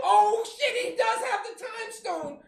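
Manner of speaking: high, loud mock shriek of shock; shocked realization. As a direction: squeal it like the whole cinema just caught on. Audience realizing the twist.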